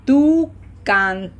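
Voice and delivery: very slow, syllable by syllable, leaning on the accented syllable; slow repeat-after-me. Shown as a demonstration. Teacher leading learners through the conjugation, slow and deliberate. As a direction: model it slowly with gaps between words. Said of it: "as slowly as I do".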